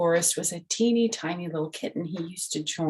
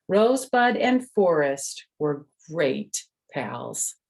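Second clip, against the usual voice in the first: lively, raised storybook voice; reading to children; 5 semitones higher, 4 dB louder than usual. A narrator's reading. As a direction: tell it like a bedtime story for little ones. Reads the picture book aloud.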